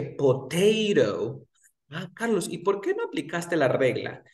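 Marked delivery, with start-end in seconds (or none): 0.0-1.5 potato said slowly and clearly; slow the example down; slow model potato; slow demo. Shown as a demonstration. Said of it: "Aquí apliqué la regla".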